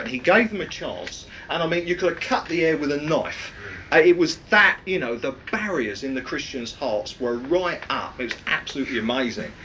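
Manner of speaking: normal talking voice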